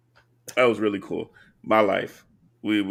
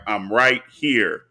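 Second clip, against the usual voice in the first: drawn out very slowly, teasing; slow reveal; 3 semitones lower, much slower than usual. Retold or imitated. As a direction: stretch each word, playful. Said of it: "I just pop up and be like".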